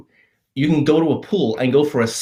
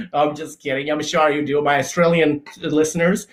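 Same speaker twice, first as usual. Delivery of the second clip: ordinary delivery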